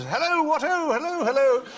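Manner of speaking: hammed-up English accent, very high-pitched hellos; Hugh Laurie. Actor lopes forward to greet a friend, in an exaggerated English accent. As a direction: parody an over-the-top English greeting, high and loud. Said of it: "trapped between two accents".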